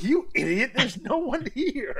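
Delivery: high, slow scornful jeer; mocking insult. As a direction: sneer at the crowned rival. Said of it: "He's like".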